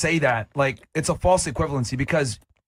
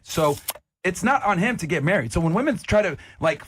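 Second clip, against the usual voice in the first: usual speaking voice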